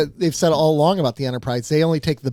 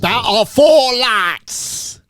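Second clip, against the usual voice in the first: Picard impression; 6 semitones higher, 5 dB louder, much slower than usual. Quoting Picard's famous line.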